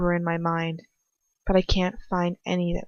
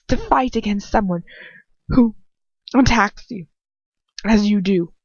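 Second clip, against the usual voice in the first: loud, higher, demanding interrogator; menacing villain voice; 5 semitones higher, 5 dB louder than usual. Acted. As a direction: press him like a cruel dark lord. Villain lectures on courage.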